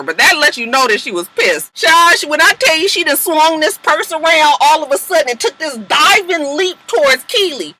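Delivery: ordinary talk, nothing marked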